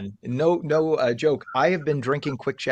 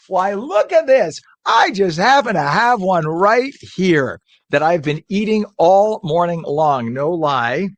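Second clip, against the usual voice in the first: excited; 6 semitones higher, 9 dB louder, slower than usual. Acted. Host hams up product reveal.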